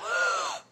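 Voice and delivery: very high mock gasp; exaggerated scandalised intake of breath; shocked gasp. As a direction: gasp in hammed-up horror. Acts church reaction to crying.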